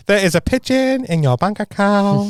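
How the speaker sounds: slow, high-pitched comic hacker voice; phone hacker bit. Acted. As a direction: draw it out slowly as a silly phone scammer. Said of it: "I love home phone hacker".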